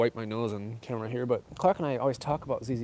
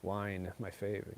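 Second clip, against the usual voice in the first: ordinary talk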